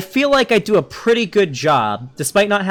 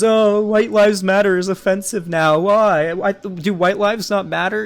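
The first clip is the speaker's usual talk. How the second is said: higher-pitched mocking complaint; mock offended; 4 semitones higher than usual. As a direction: imitate someone feigning outrage, pitched up.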